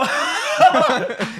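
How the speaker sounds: high, loud cackling laugh; real burst of laughter. Real, not acted. Storyteller cracking up at his friends' joke, real loud laughter. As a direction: crack up helplessly.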